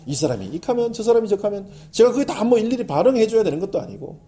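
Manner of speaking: usual speaking voice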